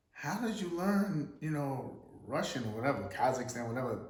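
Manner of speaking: put-on astonished woman asking in a heavy Russian accent; strong Russian accent. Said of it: "a really strong accent".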